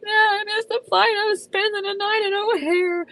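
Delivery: retold wail of defeat; mock sobbing reply. Answering her cousins while crying, mock wail.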